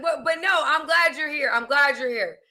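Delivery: ordinary talk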